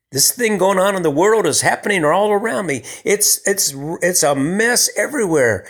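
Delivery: high, swelling preacher's cry; impassioned preaching. Acted. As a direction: preach it with rising fervor. Preaching God's shelter, pitch lifted.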